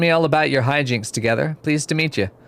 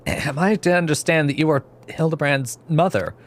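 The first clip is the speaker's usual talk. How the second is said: usual speaking voice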